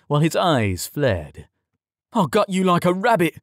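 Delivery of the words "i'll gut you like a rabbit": high, vicious threat; spat threat. A narrator's reading. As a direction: spit it at him. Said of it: "Tom spat".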